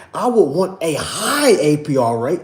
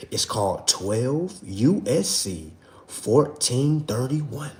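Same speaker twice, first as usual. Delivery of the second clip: slow dictation; 5 semitones lower, 6 dB quieter, slower than usual. Shown as a demonstration. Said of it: "One more time".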